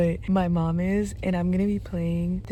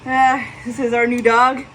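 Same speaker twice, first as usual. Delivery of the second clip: ordinary talk, nothing marked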